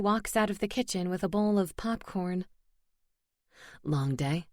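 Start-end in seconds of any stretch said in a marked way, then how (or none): 3.8-4.5 male voice. Acted. Corbin asks her.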